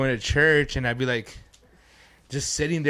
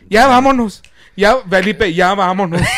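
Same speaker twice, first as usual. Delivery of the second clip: louder, higher retold scolding in Spanish; raised-voice "we're leaving"; upset mother; 5 semitones higher, 8 dB louder than usual. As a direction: imitate an exasperated mom ordering her son out. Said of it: "She was so up-, upset".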